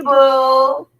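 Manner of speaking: ordinary talk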